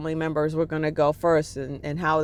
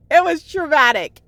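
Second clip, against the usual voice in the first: It was traumatic said higher and louder, voice lifted by emotion; emotional outburst; 9 semitones higher, 6 dB louder than usual. Real, not acted. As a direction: blurt It was traumatic out as the feeling overwhelms you. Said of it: "I'm, like, getting emotional now".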